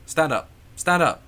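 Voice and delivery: loud, high repeated command; mock urgent command; barked order. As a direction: imitate a guide shouting at someone on the floor. Imitates guide ordering him up.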